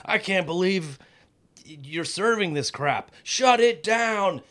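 high mock rant building to a slow, drawn-out shout; Chef Ramsay rant. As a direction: tear into the kitchen like Chef Ramsay, ending in a yell. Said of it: "and he's all".

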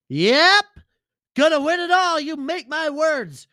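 high, loud put-on sidekick voice; a brash alter ego crowing his pick; Lenny voice. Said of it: "Lenny, what do you got?".

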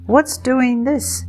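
high, slow little squeak; a tiny kiwi awed by the geyser. A narrator's reading. Kapai squeaks in wonder watching the geyser, high and slow. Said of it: "squeaked Kapai".

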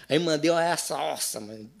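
normal talking voice

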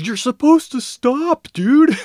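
high, loud, drawn-out mock yell; imitated yell. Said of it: "and yells at me".

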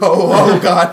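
loud startled "oh God"; startled gasp. Retold or imitated. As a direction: blurt it out, startled. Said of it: "I was like".

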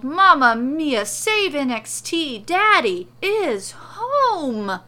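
loud, high, slow Italian-flavoured cry; slow, loud and high, overjoyed; excited exclamation. Acted. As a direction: shout for joy, dragging it out.

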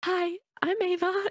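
put-on high, slow e-girl voice; cutesy high little hello, drawn out; breathy cutesy giggly. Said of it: "I spoke like an e-girl".

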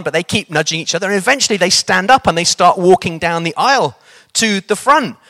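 ordinary delivery

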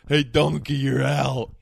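loud, jeering umpire call; umpire calling strikes. Pretend. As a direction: yell it like a heckling ump. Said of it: "That's what they used to say to Adam Dunn".

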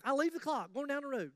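usual speaking voice

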